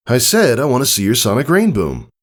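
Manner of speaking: breezy, a bit boastful; friendly older stallion. Acted. Tycho telling Scootaloo about learning it, casually.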